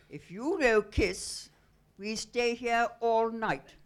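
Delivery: loud, slow broken-English Greek ultimatum; Greek fisherman. Retold or imitated. Fisherman refusing to take the women back: loud, slow Greek broken English. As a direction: lay down the terms like a stubborn Greek fisherman. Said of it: "And we said".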